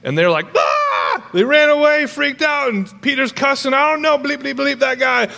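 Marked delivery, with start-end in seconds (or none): 0.6-0.7 short freaked-out yell; panicked scream. Acted. Disciples reacting to the risen Jesus, panicked scream. 1.3-5.3 very high-pitched hammed-up freak-out; frantic panic. Preacher mimics the disciples fleeing and Peter cussing, frantic high voice.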